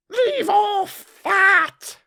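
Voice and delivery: very high, loud, long-drawn screech; screamed command. A narrator's reading. Queen at the bowing gardeners, screaming. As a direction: scream it slowly, every word stretched. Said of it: "screamed the Queen".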